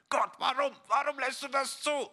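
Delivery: hoch und laut klagend wie ein getroffenes Kind; empört jammern, höher; jammerndes Kind. Acted. Junge klagt nach Steinwurf. (Kind nach dem Steinwurf klagt Gott an, hoch und jammernd)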